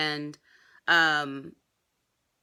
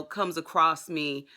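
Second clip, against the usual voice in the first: ordinary delivery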